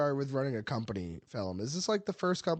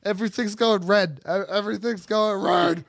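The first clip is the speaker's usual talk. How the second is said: loud, seething mock rage; rage mode; 4 semitones higher, 6 dB louder than usual. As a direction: shout it like seeing red. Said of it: "he's in rage mode".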